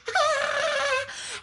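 high shrieking cackle; hyena cackle. Acted. The hyena gloats over the trapped queen with a shrill cackle. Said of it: "He cackled with his ugly voice".

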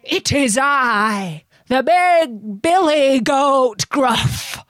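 slow, hoarse big billy goat voice; ugly, hoarse goat. A narrator's reading. Big goat answers troll, ugly hoarse voice. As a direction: announce yourself slowly, hoarse and big. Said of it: "who had an ugly, hoarse voice".

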